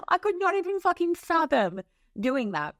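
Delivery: high, incredulous laugh-talk; laughing disbelief. Real, not acted. Speaker scoffing at cursing someone herself, laughing disbelief.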